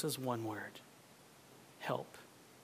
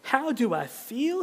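higher, louder, bursting with joy; joyful cry; 8 semitones higher, 8 dB louder than usual. Acted. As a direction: let Sam's delight break out. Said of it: "he cried".

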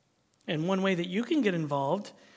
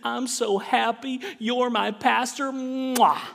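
put-on older church lady in a slow, higher, thick Texas accent; 5 semitones higher, 6 dB louder, slower than usual. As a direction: gush slowly in a sweet Texas drawl, ending on a loud kiss. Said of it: "in her very thick Texas accent".

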